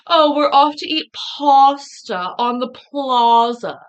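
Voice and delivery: "pasta" and "plaza" with drawn-out "ah" vowels, high and loud; mock "PAH-sta" and "PLAH-za", slow and exaggerated; mocking pronunciation. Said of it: "What would you say?".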